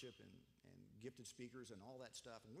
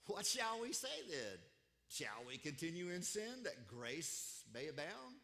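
loud, declaimed questions; emphatic scripture reading; 2 semitones higher, 7 dB louder, slower than usual. Acted. Preacher quoting Paul's question to the church, emphatic. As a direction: read the verse out forcefully.

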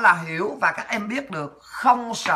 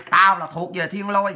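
ordinary delivery

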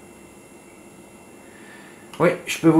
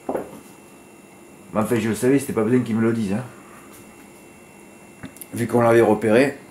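ordinary talk

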